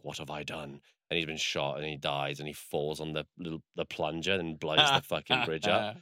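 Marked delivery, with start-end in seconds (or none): 0.0-0.8 soft, low acted regret; retold dying realisation. Shot officer realising his mistake, softly acted dismay. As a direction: let it drop quietly, lightly acting the dying man.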